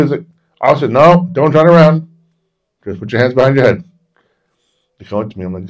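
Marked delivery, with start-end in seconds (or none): 0.6-3.8 loud, high imitation of a cop; shouted police order. Police ordering a man not to turn around, shouted command.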